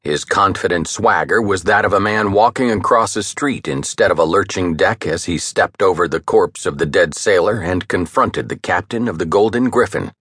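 ordinary delivery

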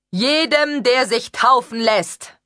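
high, loud, slow proclamation; a friar's slow, loud and high public call; proclaiming loudly. Acted. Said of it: "rief der Dominikaner".

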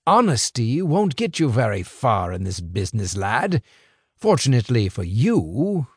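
put-on a sly older fixer, amused and in control; smooth crime boss. Crime boss lecturing two young couriers, sly and amused. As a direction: toy with the boys like a patient boss.

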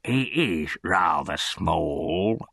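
slow, drawn-out concession; lightly voiced beadle. A narrator's reading. Beadle answers the undertaker's wife about the boy, slow concession. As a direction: stretch the words out a little pompously.

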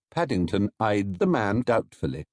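ordinary delivery, nothing marked

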